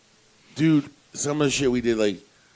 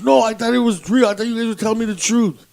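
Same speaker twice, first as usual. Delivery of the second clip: whiny friend; 9 semitones higher, 6 dB louder than usual. Retold or imitated. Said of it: "He goes".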